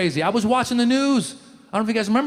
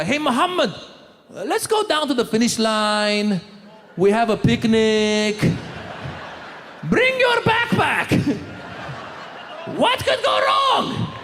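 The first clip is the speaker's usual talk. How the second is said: Moroccan friend; 3 semitones higher, slower than usual. Retold or imitated. Voicing Moroccan guy's phone call.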